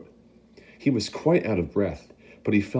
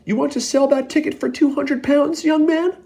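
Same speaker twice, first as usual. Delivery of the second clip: much higher, louder bidding; shouting; 5 dB louder than usual. Acted. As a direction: outbid him loudly. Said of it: "shouted a woman".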